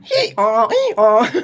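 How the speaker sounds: braying hee-haw like a donkey; mock donkey hee-haw. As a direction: bray it out like a jackass. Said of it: "And I went".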